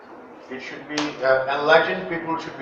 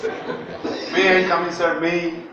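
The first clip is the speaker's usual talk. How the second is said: ordinary talk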